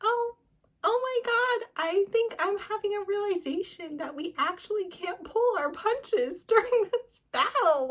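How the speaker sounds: mock her belated epiphany; hammed-up airhead realization; mock dawning realization. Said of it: "she was like".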